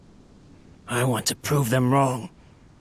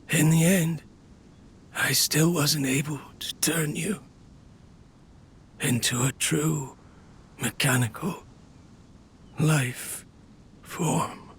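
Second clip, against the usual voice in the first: dying whisper. Acted. Dying inventor apologizes to his robot, fading whisper. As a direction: let the voice drain away word by word.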